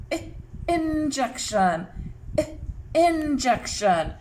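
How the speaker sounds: first sound "I" alone as a short ih, then "injection" slowly. Shown as a demonstration. Teacher helping children hear the first sound, slow "injection". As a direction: isolate "I" as ih, then stretch "injection". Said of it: "Let's say it slowly".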